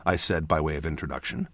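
ordinary delivery

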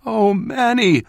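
high, loud, tearful mother's voice; acted cry, choking back tears; holding back tears. A narrator's reading. Mother to her departing child, holding back tears. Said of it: "she said, holding back tears".

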